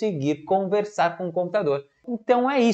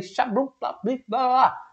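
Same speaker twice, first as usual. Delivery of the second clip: gibberish talk; 5 semitones higher than usual. Shown as a demonstration. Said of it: "eu não falei nada com nada".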